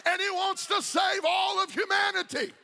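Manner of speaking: full-force preaching. Acted. Pastor urging the congregation that God wants everyone saved, full-force preaching. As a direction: preach it out to the whole church.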